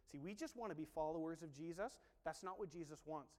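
usual speaking voice

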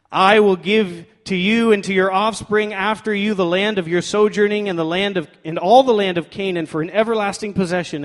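loud, emphatic scripture reading; declaring. Acted. God declares the promise to Abram, declaring loudly. Preacher reads God's promise aloud.

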